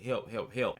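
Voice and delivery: ordinary talk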